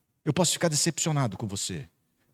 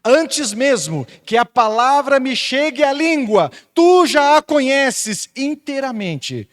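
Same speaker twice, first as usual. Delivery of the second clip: higher, louder and slow, each word weighed; proclaimed scripture; 9 semitones higher, 7 dB louder, slower than usual. Acted. Pastor reading Psalm 139 to the congregation, slow proclaiming. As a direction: proclaim the psalm over the church.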